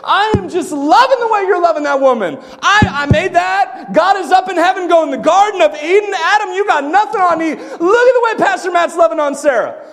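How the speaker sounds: high, loud, gushing proud father; delighted God. Acted. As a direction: play a heavenly father bursting with delight. Acts out God exulting over the marriage.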